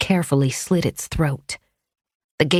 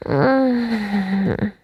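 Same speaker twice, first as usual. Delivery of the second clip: sleepy whimpering hum; 5 semitones higher than usual. A narrator's reading. Sleepy wife grunting.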